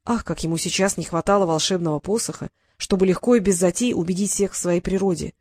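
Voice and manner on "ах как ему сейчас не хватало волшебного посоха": ordinary delivery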